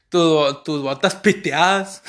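usual speaking voice

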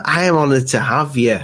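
ordinary delivery

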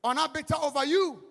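high, loud reading of Jesus' reply. Acted.

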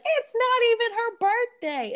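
high, drawn-out tattling imitation; Jocelyn. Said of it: "Jocelyn tried to blow up my spot".

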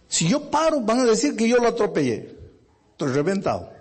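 high, torn, pleading with himself; anguished inner voice. Acted. Taxi driver torn over the injured boy, high and anguished. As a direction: voice the driver's panic in his head.

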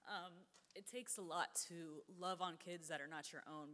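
ordinary talk, nothing marked

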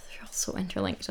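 breathy sighing whisper. Real, not acted. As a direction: sigh it out under your breath. Sighing aside.

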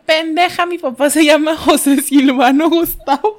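loud scolding. Retold or imitated. Friend scolding her for insulting her dad, loud and slow. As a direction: hiss the awful truth loudly. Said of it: "la Karina".

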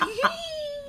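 a sudden high squeal of a laugh; squeaky high laugh. Real, not acted. Guest caught off guard by the host, a squeaky high laugh. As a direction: let a high-pitched laugh slip out.

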